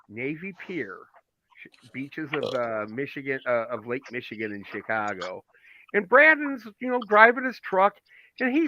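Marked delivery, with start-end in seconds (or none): 0.1-5.4 hushed scene-setting whisper. Acted. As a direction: breathe the words as if painting a picture. Scene setting, imagine the beach.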